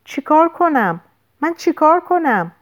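high-pitched, desperate cry for help; panicked cry. A narrator's reading. Narrator crying out to Dana for instructions, panicked cry. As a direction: cry out helplessly, pitch jumping up. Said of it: "فریاد زدم".

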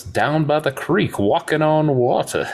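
high-pitched gunslinger impression; acted Old West quote, high and lilting; Doc Holliday. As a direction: answer as the movie gunslinger, pitch lifted well up. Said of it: "Doc goes".

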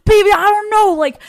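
high, loud whiny pleading; mock pleading ex. Caught ex pleading innocence, high and whiny. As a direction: whine excuses like a caught man. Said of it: "he's been like".

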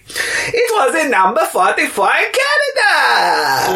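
very high, drawn-out mock cry; hammed-up announcement. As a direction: wail the chart position out slowly. Hammy chart announcement.

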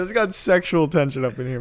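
ordinary talk, nothing marked

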